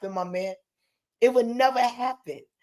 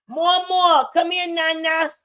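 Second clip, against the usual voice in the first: calling out; 5 semitones higher, 4 dB louder than usual. Real, not acted. Calling child over.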